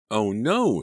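high, loud shocked exclamation; gasped dismay. A narrator's reading. Girl hearing of parents splitting up, gasped dismay. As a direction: react with sudden alarm. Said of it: "Selina gasped".

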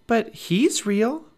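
high, slow hushed awe; awed Annie. Acted. Annie says in slow awe to Jack about the pteranodon. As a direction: marvel at it slowly.

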